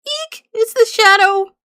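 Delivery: high-pitched frightened squeal rising in volume; squeal, then cry out in alarm; panicked shriek. Acted. Islander spotting the shadow, panicked shriek.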